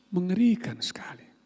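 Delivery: hushed, breathless whisper of horror; whispered dread. Real, not acted. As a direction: drop to a whisper as if it's too awful to say aloud. Preacher's horror at shaman use.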